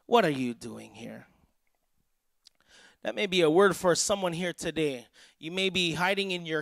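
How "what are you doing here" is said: low, measured, probing question; voice of God. Retold or imitated. God questions the hiding prophet, low and solemn. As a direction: drop deep and let the question hang. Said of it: "there came a voice to him".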